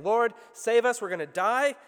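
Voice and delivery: loud desperate cry for rescue; frightened disciples shouting to Jesus; panicked shout. Acted. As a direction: yell it like the boat is sinking.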